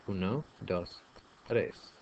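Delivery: ordinary talk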